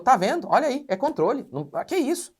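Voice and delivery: high, loud indignant outburst imitating the member; mock the offended member's protest; angry retort. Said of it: "ele ficou bravo e falou".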